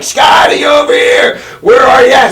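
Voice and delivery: high calling out across the parking lot; mock yell. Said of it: "like, yell".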